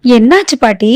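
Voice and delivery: ordinary delivery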